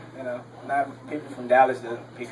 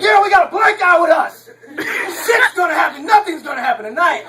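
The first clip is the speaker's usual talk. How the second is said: high, loud excited shouting; hollering mock hype, pitched way up; overexcited; 8 dB louder than usual. Car full of guys hyped about their new passenger, overexcited. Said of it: "they're all excited".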